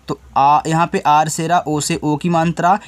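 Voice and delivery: ordinary talk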